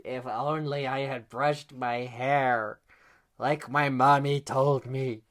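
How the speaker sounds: slow, hammed-up regret; mock lament. Girl regretting to herself, slow mock lament.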